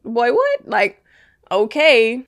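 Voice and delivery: put-on high, loud incredulous boy what. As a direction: call out in disbelief. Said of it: "And I'm just like".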